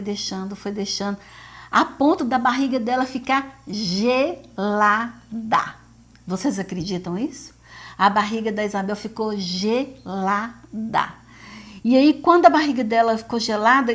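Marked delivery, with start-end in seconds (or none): 3.7-5.1 "gelada" broken into three slow syllables; ênfase silabada. Real, not acted. Enfatiza a barriga sem febre. 9.4-11.2 slow, separated syllables on "gelada"; ênfase silabada. Real, not acted. Repete o milagre com ênfase.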